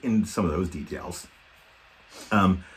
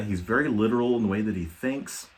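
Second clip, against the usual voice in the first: ordinary talk, nothing marked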